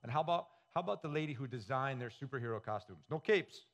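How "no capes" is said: light mock character exclamation; higher, louder, lightly acted catchphrase; Edna Mode. Quoting Edna Mode's catchphrase.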